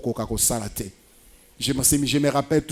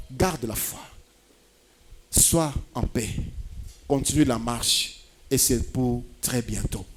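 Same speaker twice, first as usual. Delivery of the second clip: soft, unhurried pastoral comfort; quiet, calming encouragement with long pauses; gentle reassurance; 2 semitones lower, 5 dB quieter than usual. Real, not acted. Preacher reassures the waiting believers.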